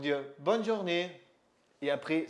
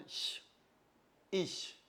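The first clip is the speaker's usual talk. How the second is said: normal talking voice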